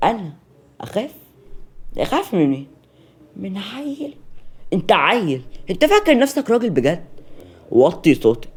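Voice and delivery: loud, scornful challenge; angry challenge. Acted. Serene confronting Selim in the kitchen, angrily scornful. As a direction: cut him down with contempt. Woman confronting the man who threatens her.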